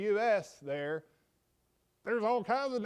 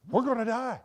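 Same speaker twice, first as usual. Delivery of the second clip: louder, higher mock alarm; retold alarm; 6 semitones higher, 5 dB louder than usual. Lifelong fishermen waking Jesus, retold alarmed cry. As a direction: call it out as if doomed.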